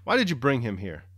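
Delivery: a woken man snapping at the noise; cross. A narrator's reading. Nicholas woken from sleep scolding his wife, cross and loud. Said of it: "saying crossly".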